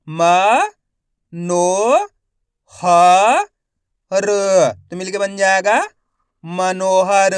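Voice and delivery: slow spelling drill, each syllable held with a rising end; stretched syllables for beginning readers; letter-by-letter sounding out. Shown as a demonstration. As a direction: sound out each letter, then blend the word. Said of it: "एक एक अक्षर का उच्चारण कर रहा हूँ".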